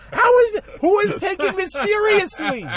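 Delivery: high, loud disbelief; incredulous. Retold or imitated. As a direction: cry out in mock outrage. Incredulous at serious movie.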